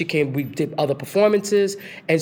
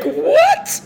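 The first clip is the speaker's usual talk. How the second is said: shocked disbelief; 7 dB louder than usual. Retold or imitated. Stunned by Chita phoning.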